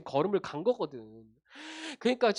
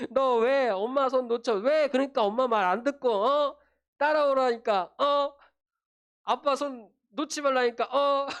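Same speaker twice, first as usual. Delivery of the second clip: higher, pressing telling-off with sharp questioning "어?"; mock parental scolding; 4 semitones higher, 3 dB louder than usual. Parent telling off the child who let go of their hand, mock angry. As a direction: play an exasperated parent demanding an answer.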